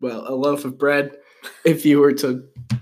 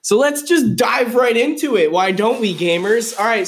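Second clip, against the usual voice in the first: hyped up; 6 semitones higher, 5 dB louder than usual. Acted. Hyped show segment start.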